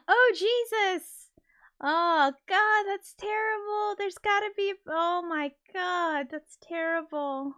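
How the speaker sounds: appalled at the thought; real horror, slowly fading; horrified. Real, not acted. Reacting to landing gear fact.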